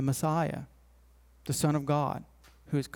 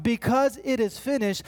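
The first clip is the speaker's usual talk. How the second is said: higher proclaimed cry, mildly raised; retold cry, pitched up; 6 semitones higher than usual. Preacher quotes resurrected Jesus from the tomb, mildly crying out. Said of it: "cries out".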